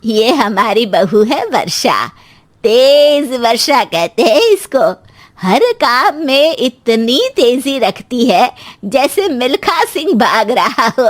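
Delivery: slow, drawn-out proud introduction; elderly woman bragging; boastful mother-in-law. Acted. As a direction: show her off to guests, stretching words.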